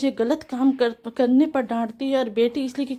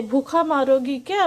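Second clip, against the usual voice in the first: ordinary delivery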